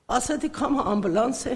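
normal talking voice

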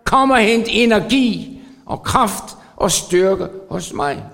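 repeated "Jeg stoler på" climbing in force; preaching at full force. Acted.

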